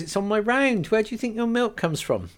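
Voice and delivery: playful know-it-all; Rose voiced, amused. Rose teases Kate about where her milk comes from.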